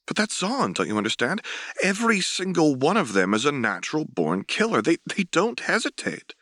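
growing intensity. Acted. Interviewee grows intense about Zaun.

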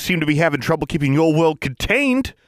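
a pushy bureaucrat scolding; Mister Johnson's voice. Acted.

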